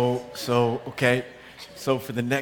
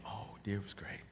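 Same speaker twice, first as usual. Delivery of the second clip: hushed, low, placating reply; careful sweet answer; 8 semitones lower, 17 dB quieter than usual. Acted. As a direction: answer the wife very softly.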